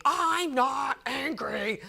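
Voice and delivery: slow, growled mock outburst; angry denial. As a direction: imitate a grouch bellowing his denial, drawn out. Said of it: "Why are you always so angry".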